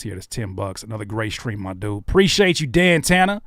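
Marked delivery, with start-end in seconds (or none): 2.0-3.4 loud, high shout-out to a donor; loud thanks. Real, not acted. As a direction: call out the thanks with energy. Shouting thanks for super chat.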